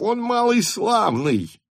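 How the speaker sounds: high, drawn-out cry of praise; excited shout. A narrator's reading. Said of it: "закричал Денисов". (восторженный высокий выкрик)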